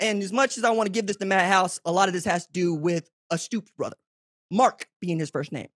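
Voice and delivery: ordinary delivery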